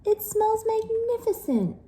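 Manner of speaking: very high awed voice; gasping. Acted. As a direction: gasp in delight. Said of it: "gasped Gilda".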